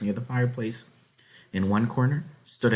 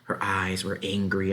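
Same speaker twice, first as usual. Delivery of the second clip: ordinary delivery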